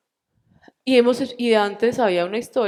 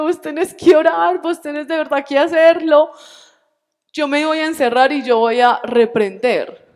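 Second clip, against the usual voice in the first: insistent raised voice, pressing hard; urgent plea; 6 semitones higher, 9 dB louder than usual. Retold or imitated. Wife urging her husband to pray, insistent raised voice. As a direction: demand he pray, pushing louder.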